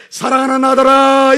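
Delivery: a hint of a loud, slow calling God; God's voice. Preacher voicing God calling out to his beloved son. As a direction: call out slowly and loudly like a father calling his son.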